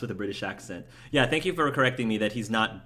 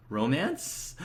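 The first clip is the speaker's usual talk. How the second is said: ROMANCE swinging up into a question; 4 semitones higher than usual. Acted. Reading out a one-word chat question, questioning. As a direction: lift the end of ROMANCE like a question mark. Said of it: "Question mark?".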